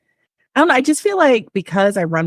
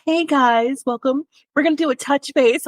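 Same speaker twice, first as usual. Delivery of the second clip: mock-perky, high corporate greeting; 9 semitones higher than usual. Business owner mocking her own corporate email to colleagues, high corporate voice. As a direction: put on a high-pitched office-email voice. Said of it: "turned into corporate [name] now".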